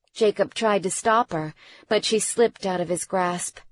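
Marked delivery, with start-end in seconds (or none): none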